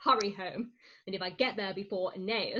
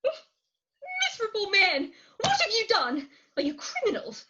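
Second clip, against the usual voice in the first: horrified scolding; 10 semitones higher than usual. Acted. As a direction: scold them in horror. Scolding in fright.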